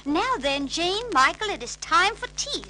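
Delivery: high, loud firm call; firm nanny. A narrator's reading. Mary Poppins calling the children to tea, firm. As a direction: call them down firmly, primly. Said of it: "said Mary Poppins firmly".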